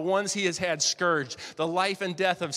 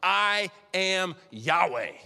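"I am Yahweh" very slow, stress on "I"; solemn slow declaration; much slower than usual. Acted. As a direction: claim the name slowly. Jesus claiming divine name.

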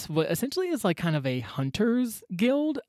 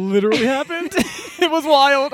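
laughing, amazed, louder; excited laughing; 5 semitones higher, 6 dB louder than usual. Real, not acted. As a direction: laugh it out in disbelief. Excited reaction to story.

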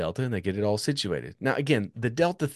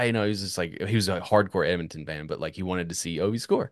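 ordinary delivery

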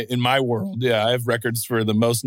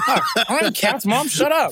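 laughing exasperated son; 11 semitones higher than usual. Retold or imitated. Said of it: "And I'm like".

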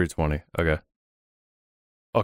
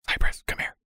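urgent hushed call; whisper yell. Acted. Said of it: "whisper yell".

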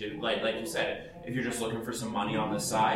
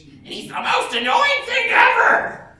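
sudden screeching yell, much higher and louder, slow; Gilbert Godfrey on stage; 12 dB louder, slower than usual. Retold or imitated. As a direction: blast out the annoying stage voice. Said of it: "You know his horribly annoying voice?".

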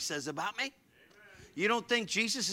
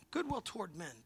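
softly spoken; 2 semitones higher, 10 dB quieter than usual. Shown as a demonstration. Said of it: "Been talking about that all week".

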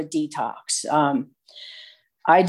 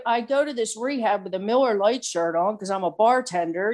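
usual speaking voice

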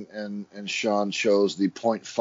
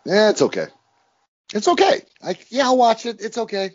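high, half-hearted meh; lightly hammed-up indifference; mock shrug; 9 semitones higher, 4 dB louder than usual. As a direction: shrug it off like it barely matters. Voicing a shrugging okay verdict.

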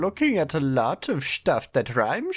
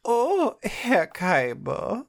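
high, slow drawn-out greeting; mock German accent; 9 semitones higher, much slower than usual. Voicing Siegfried.

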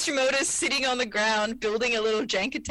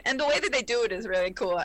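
ordinary talk

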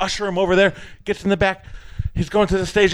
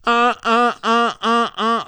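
alarm beeps. Retold or imitated. Storyteller mimics the alarm going off, alarm beeps. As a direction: beep out an alarm in even bursts.

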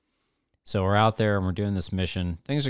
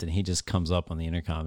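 usual speaking voice

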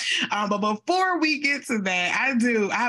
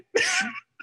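laugh. Real, not acted. Laughing at grandfather's jab.

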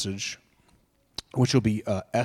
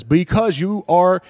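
menacing decree; 5 semitones higher, 4 dB louder than usual. Acted. Preacher voicing the decree of death to the congregation, menacing. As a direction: sound like the ruler condemning a people. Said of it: "the passage that says".

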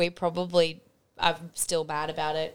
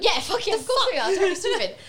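excited; 11 semitones higher, 4 dB louder, faster than usual. Real, not acted. Enthusiastic podcast opener.

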